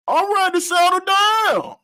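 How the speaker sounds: mimicked woman. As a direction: mimic a woman announcing it in a high, stretched voice. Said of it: "Then you come back talking about".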